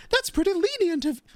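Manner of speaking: high, put-on reporter voice; Charlotte. Demo for viewers of the reporter, high and put-on. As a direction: try out a high character voice. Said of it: "And Charlotte's more like".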